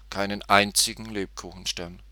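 usual speaking voice

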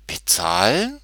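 puzzled echo, rising at the end; astonished question. A narrator's reading. Tutti hears she must pay, astonished. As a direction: echo it as a baffled girl's question. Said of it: "Tutti war erstaunt".

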